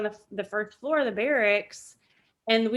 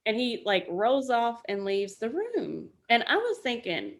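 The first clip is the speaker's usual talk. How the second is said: ordinary talk, nothing marked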